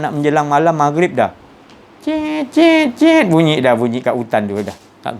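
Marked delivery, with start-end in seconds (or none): 2.1-3.2 high sharp cet cet chirps in imitation; mimicked insect chirps. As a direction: chirp like night insects in the graveyard. Night sounds at the graveyard. (bunyi cengkerik diajuk tinggi)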